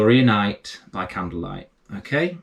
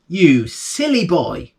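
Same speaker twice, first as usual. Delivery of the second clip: slow, higher exasperated mum; lightly voiced mother tutting; scolding mother; 7 semitones higher, 4 dB louder, slower than usual. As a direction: drag out the scold like a fed-up mother. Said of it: "said his mother".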